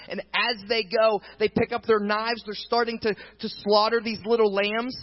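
loud, high, driving sermon build; fiery preacher building to a climax; preaching at full force. Acted. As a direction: drive the scene home to the congregation.

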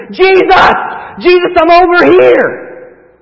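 high, loud, desperate calls for Jesus; screaming for help. Acted. Preacher acting a drowning man screaming for Jesus, loud and desperate. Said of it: "you begin to scream".

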